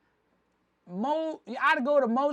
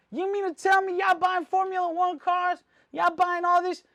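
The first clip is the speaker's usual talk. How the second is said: raised, louder voice in disbelief; incredulous challenge; 8 semitones higher, 5 dB louder than usual. Retold or imitated. Challenging flashy dealers over their spending, incredulous. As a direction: call them out, pitched high and loud.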